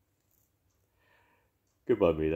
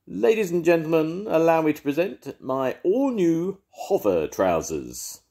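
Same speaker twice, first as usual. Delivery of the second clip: much higher, louder grand showman voice; showman Rhubarb; 10 semitones higher, 4 dB louder than usual. Acted. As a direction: present the invention like a ringmaster. Rhubarb presents his invention.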